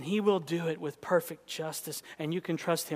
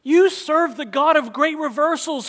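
loud, high proclaiming call; booming pulpit declaration; loud preaching; 10 semitones higher, 13 dB louder than usual. Acted. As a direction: throw it out to the whole room. Loud preached line, then hushed repeat.

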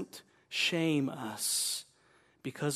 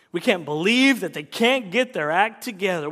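ordinary talk

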